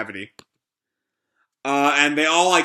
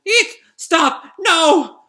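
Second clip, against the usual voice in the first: very high, loud shrieked protests; panicked squeals; 6 dB louder, much slower than usual. Acted. Pino crying out as she is attacked, panicked squeals. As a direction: squeal in acted terror.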